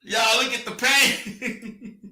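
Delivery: slow words through laughter; slow amused mockery; laughing through it. Real, not acted. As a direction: laugh while dragging it out. Laughing, drawn-out mocking.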